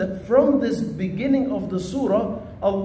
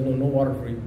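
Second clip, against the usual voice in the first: put-on lower, firm refusal from an old teacher; stern sheikh; 7 semitones lower, faster than usual. Sheikh refusing the thirsty student, low and firm. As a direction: deny him flatly, like a strict sheikh.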